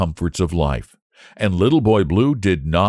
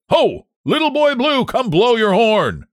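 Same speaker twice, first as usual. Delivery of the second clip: angry cry; 3 dB louder than usual. A narrator's reading. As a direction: call the boy across the fields, pitched way up. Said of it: "he cried".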